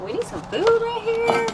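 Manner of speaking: high, slow little cat voice; voicing the kitties. Owner voicing her hungry cats, high and slow. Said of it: "They're like".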